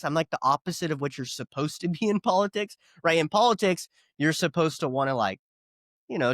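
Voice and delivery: normal talking voice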